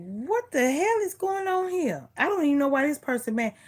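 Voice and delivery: high-pitched mock bewilderment; baffled outburst. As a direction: throw up your hands at someone mad for no reason. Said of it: "And I be like".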